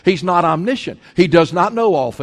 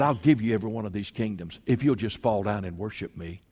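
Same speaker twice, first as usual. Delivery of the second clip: low, hushed tempter's voice; tempting devil; 7 semitones lower, 10 dB quieter than usual. Acted. Devil offering Jesus the world's kingdoms, low and hushed tempting. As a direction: coax softly and low, like a sly offer.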